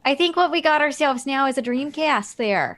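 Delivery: lightly voiced Southern clerk; folksy clerk letting a customer down gently; Southern accent. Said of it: "like, Southern".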